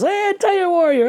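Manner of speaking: high, drawn-out mock hail; imitating lads hollering a greeting, very high; excited calling out. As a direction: call out like a fan spotting him. Acting out lads calling to him.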